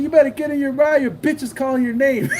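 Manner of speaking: high, jeering delivery breaking into a laugh; retold mocking taunt. Seller sending rival back to his lady, high laughing taunt. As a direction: needle him, amused at your own jab.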